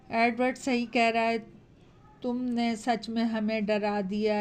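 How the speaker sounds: ordinary delivery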